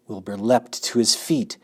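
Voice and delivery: ordinary delivery, nothing marked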